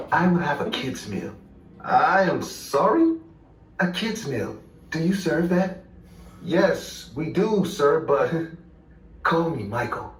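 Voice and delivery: hint of breathy Michael Jackson. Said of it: "imitating [name]'s voice".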